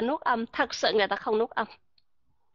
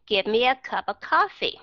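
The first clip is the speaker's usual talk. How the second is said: connected American phrasing, "cup of" linked; quick native linking; slower than usual. Shown as a demonstration. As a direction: run the words together as one phrase. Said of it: "họ nói rất là mau".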